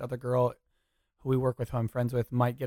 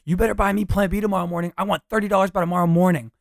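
higher and louder, bossy demand; the girl's demanding voice; 7 semitones higher, 6 dB louder than usual. Shown as a demonstration. Girlfriend yelling demands at her boyfriend, high and bossy. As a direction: shout it through the door at him.

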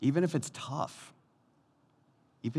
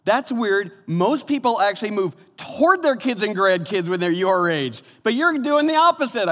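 high, loud mock-amazed remark; exaggerated voice of someone pointing out the obvious; astonished acquaintance; 10 semitones higher, 7 dB louder than usual. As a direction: imitate a baffled busybody, pitched way up. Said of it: "somebody came up and they're like".

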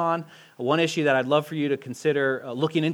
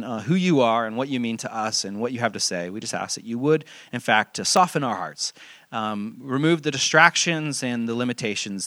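prayer; 5 semitones lower than usual. Acted. Leads prayer before teaching.

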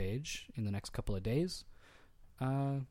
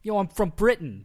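mock British accent; 8 semitones higher, 10 dB louder than usual. Joking impression after accent.

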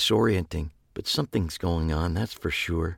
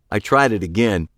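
usual speaking voice

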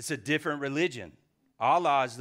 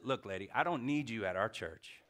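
normal talking voice

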